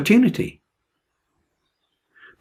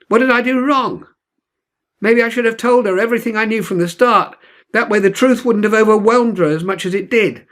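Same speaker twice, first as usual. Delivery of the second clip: raised, anguished voice pouring out hurt; bitter despair aimed at the ocean, pitched up; a boy grieving a lost love, pitched up in pain; pained lament; 8 semitones higher than usual. Acted. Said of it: "listening to the pain in my voice".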